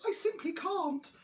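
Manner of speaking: high little dinosaur voice; small high-voiced refusal; apologetic youngster. A narrator's reading. Young dinosaur refusing food in story.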